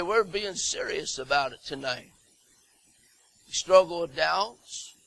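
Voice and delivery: usual speaking voice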